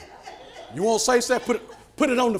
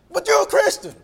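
high-pitched retold outcry; shocked protest; 14 semitones higher, 6 dB louder than usual. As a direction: exclaim it in acted disbelief. Shocked reaction voiced in story.

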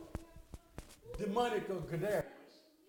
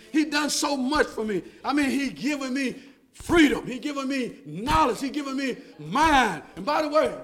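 fired-up preaching; 5 semitones higher, 12 dB louder than usual. Acted. Preacher urging the congregation to get fired up, preaching hard. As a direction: lift the church with every line.

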